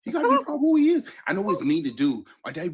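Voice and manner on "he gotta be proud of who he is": higher, louder and faster insistence; fired-up insistence; emphatic. Real, not acted. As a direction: push the point hard and fast. Emphatic defense of teasing.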